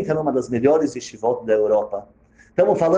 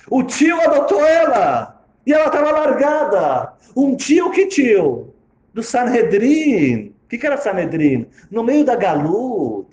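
high, slow, sweet storybook voice; fairy-tale telling; 7 semitones higher, 3 dB louder, slower than usual. Acted. Preacher retelling the Megillah as Disney would, in a slow storybook voice.

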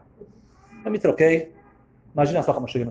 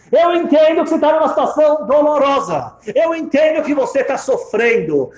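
high, loud, impassioned voice; emphatic insistence; 11 semitones higher, 6 dB louder than usual. Retold or imitated. Old woman to crying stranger, emphatic insistence. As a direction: drive the understanding home forcefully.

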